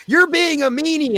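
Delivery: put-on high childish accusation; kids accusing. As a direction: scold like a child. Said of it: "you said".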